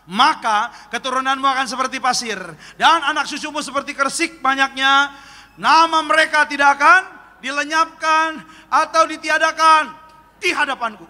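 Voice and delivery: loud, rising preacher's reading; scripture reading. Acted. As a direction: proclaim the verse to the congregation. Reads scripture aloud. (pembacaan ayat berapi-api)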